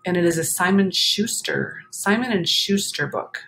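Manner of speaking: plain reading voice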